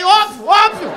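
high, very loud retort; exasperated shout. Acted. Reacting to her yes, exasperated shout. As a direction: blurt it out at full volume.

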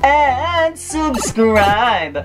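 high, very slow stretched-out sign-off; drawn-out sing-song outro. Acted. Vlogger signing off to her merman and mermaid fans, slow high sing-song. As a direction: stretch every word like a sung farewell.

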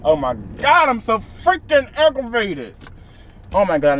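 losing it over the camera mount; frustrated spaz. Real, not acted. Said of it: "I'm trying not to spaz".

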